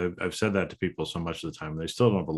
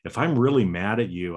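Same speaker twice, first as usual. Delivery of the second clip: ordinary delivery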